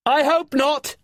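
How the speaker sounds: high, loud unsure answer; shouted reply. Acted. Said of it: "said Finn".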